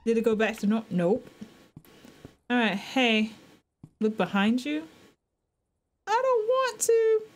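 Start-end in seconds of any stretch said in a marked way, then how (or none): 6.1-7.2 high, slow frightened whine; scared whimper. Real, not acted. Told to look behind.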